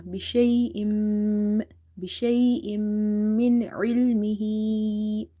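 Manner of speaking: بشيء ending in a held nasal m into من; idgham with ghunna. Shown as a demonstration. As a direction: slowly hum the merged mim in بشيء من علمه. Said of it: "you will do idgham with a gunna".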